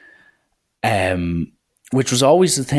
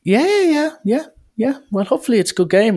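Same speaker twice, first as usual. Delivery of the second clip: high-pitched mimicked woman brushing it off; imitated female coach, high and quick; 14 semitones higher than usual. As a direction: put on a high voice and hurry through the pleasantry. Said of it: "who was a woman".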